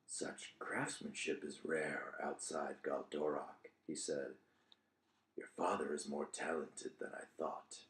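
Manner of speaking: a hint of a low, slow, guarded stranger; low hooded stranger. Stranger eyeing the hammer, low and slow.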